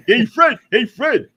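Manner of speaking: a hint of Barney calling to Fred; lightly voiced cartoon neighbour calling Fred; Barney impression. Cohost calls Fred in a light Barney Rubble voice. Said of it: "I sound like Barney".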